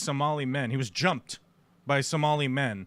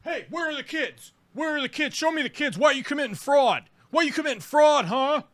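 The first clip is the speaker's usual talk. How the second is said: much higher and louder, hounding; mock nagging interrogator; 10 semitones higher, 3 dB louder than usual. Streamer imagines hounding the reporter on his own property. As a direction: badger him over and over. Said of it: "start asking you fucking crazy questions".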